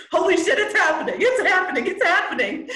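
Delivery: squealing with delight as it sinks in; thrilled disbelief. Retold or imitated. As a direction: bubble over with giddy laughter. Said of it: "I'm like".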